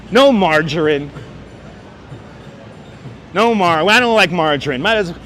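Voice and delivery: ordinary delivery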